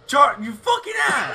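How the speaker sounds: startled angry shout; scared horror game streamer. Real, not acted. Friend scared him.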